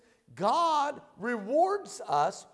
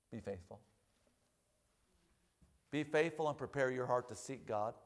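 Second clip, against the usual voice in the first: quiet urging; 10 semitones lower, 9 dB quieter than usual. Acted. Preacher softens for emphasis.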